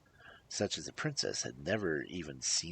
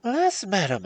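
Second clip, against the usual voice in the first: high, loud lament; sorrowful princess; 12 dB louder than usual. A narrator's reading. Princess questioned about her clothes, lamenting.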